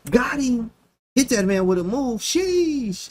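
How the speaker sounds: squeaky gloating sheesh; mocking gloating opponent. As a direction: taunt like a cocky opponent who just won the queen. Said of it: "he laugh at you like".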